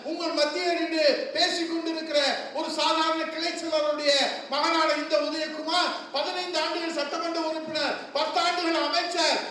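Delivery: shouted, high-pitched stage speech; fiery rally oratory. Acted. Politician roaring to party workers at the rally. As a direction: thunder it to the crowd.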